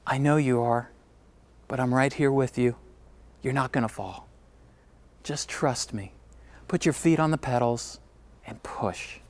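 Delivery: soft, low fatherly reassurance; gentle reassurance. Retold or imitated. Father to scared daughter on her bike, gently reassuring. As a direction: hush down and reassure a frightened child.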